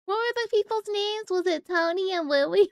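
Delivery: high, sing-song mocking voice; mock dumb question. Mocking chat who got the Titanic names wrong, high and teasing. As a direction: pretend to be confused chat.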